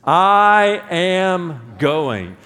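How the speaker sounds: slow, loud declaration; I am going, drawn out. Shown as a demonstration. Pastor leading the congregation, slow loud declaration. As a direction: stretch each word out as a vow. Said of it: "Say that with me".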